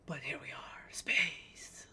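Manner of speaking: hushed unvoiced whisper. Real, not acted. Streamer whispering to chat, secretive hush. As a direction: breathe the words with no voice.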